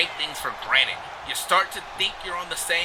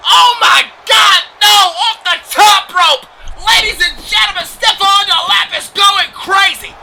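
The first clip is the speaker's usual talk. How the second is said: high, loud, drawn-out shout; frenzied play-by-play; 11 dB louder, slower than usual. Real, not acted. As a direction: scream the big move to the crowd. Live play-by-play of wrestling.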